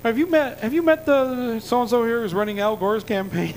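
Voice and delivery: high, hurried imitation changing the subject; an awkward dodge in a put-on presidential voice; Clinton voice. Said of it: "he goes".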